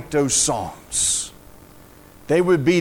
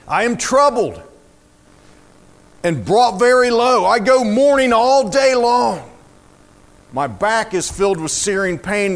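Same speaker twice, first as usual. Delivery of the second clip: high, intense declaimed lament; dramatic psalm reading; 4 semitones higher, 4 dB louder than usual. Acted. Preacher reading of mourning for a mother, intense lament. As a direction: declaim the grief to the whole room.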